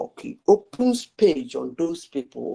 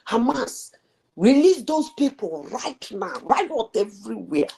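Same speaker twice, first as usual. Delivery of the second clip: higher-pitched mock outcry, not a full shout; imitated public outcry; 6 semitones higher than usual. Speaker imagines everybody screaming at Hamas, as a high mock outcry. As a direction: imitate protesters screaming their demands, pitched up. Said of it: "everybody will be screaming".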